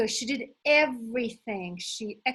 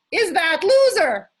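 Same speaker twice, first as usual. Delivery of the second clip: loud, high punch on that loser; emphatic punchline; 8 semitones higher, 12 dB louder than usual. Acted. Coach hitting the punchline to her audience, loud and high. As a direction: land the joke hard and loud.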